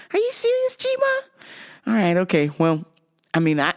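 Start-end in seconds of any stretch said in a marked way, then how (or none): 0.1-1.2 high disbelieving question; incredulous. Retold or imitated. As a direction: ask in disbelief. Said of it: "I was like".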